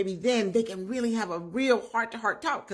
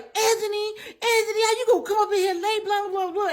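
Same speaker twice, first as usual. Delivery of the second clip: high-pitched, louder retold nagging yell; mock yelling; 12 semitones higher, 4 dB louder than usual. Woman to her late-coming man, mock high yelling. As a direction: imitate an irate woman yelling, far higher and fast. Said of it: "And she yelling at him".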